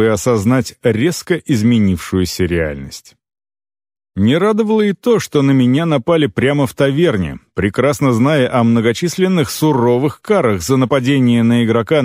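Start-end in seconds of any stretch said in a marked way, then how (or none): none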